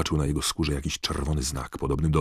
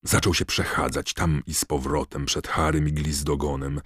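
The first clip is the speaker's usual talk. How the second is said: plain reading voice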